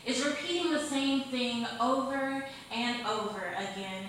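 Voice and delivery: punchy, rhythmic stage recitation, line openings hit hard; spoken-word poem. Acted. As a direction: perform the verse for an open-mic crowd. Recites her insanity poem.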